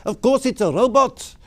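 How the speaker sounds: put-on high, loud South African accent. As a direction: imitate an emphatic South African driver. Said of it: "in a lovely South African accent".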